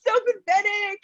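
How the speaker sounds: high, wailing "oh, I'm so pathetic" through laughter; hammed-up sad whine; mock whimpering self-pity. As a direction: whine it like a pitiful victim, half laughing. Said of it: "I had moments of".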